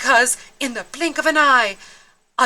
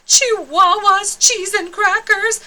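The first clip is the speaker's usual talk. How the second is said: excited silly exclamation; 10 semitones higher, 5 dB louder than usual. Acted.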